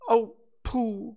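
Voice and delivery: high, loud cry for a friend; anguished cry. A narrator's reading. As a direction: call out in dismay. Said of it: "cried Christopher Robin".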